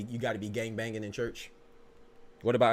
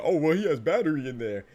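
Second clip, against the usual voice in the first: lightly voiced mocking dumb voice, louder; mocking quote; 4 semitones higher, 7 dB louder than usual. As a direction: parody his dumb take. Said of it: "That's what he said".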